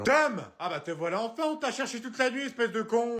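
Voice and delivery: an exasperated mum calling him out; mother character. Acted.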